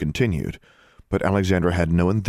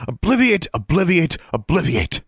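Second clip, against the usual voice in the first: snapped spells; 11 semitones higher, 5 dB louder than usual. A narrator's reading. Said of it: "she snapped".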